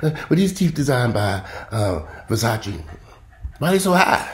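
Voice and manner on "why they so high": high, indignant "why they so high"; mock outrage. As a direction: act shocked at the price. Mock complaint about teeth prices.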